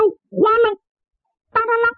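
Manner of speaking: high clinking coin sounds, sung out; high, bright onomatopoeia of falling coins; jingling coins. Retold or imitated. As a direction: ring out the coins hitting the floor. Coins clatter onto floor.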